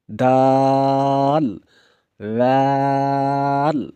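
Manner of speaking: दाल and वाल held very long; drawn-out letters. Shown as a demonstration. Teacher models long-held letter names. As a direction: stretch दाल and वाल slowly. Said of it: "letter drill".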